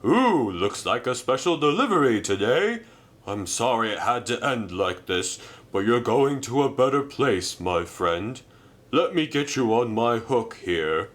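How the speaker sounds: altered crane voice, consoling; kindly crane voice. Acted. Reg the crane consoling Merlin before scrapping, altered and gentle. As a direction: comfort an engine going to scrap.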